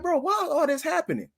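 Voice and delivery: high-pitched acted wail of complaint; exasperated plea. Man retelling his run of bad luck, exasperated. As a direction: pitch way up, as if pleading for answers.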